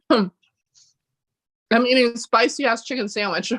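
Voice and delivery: slow, laughing through a mouthful; laughing while eating. Real, not acted. Telling the hosts about the spicy sandwich, laughing. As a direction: chuckle the words out around the food. Laughs at herself mid-meal.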